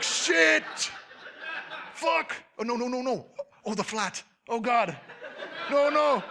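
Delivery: high, loud panicked cursing with laughter; panicked swearing. Retold or imitated. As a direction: panic as it dawns on you. Realizing goblin ears, panic.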